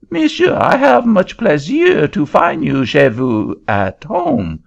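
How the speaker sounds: put-on high, polite French-accented greeting; a Frenchman masking anger in a high French accent. Frenchman greeting the auctioneer, high French accent.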